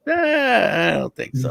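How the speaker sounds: put-on slow, doubting old-man reply; imitating doubtful grandpa. Grandpa doubts the cousin, slow sceptical voice. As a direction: shrug it off unconvinced. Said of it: "grandpa doubts the cousin".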